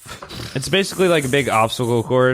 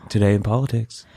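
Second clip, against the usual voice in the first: lightly lowered news-anchor read; mock NPR anchor; 3 semitones lower than usual. Co-host joins the bit as a mock public radio anchor. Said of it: "NPR parody".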